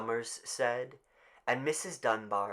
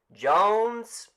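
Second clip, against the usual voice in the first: higher, louder call; raised roll call; calling out a name; 11 semitones higher, 10 dB louder than usual. Acted.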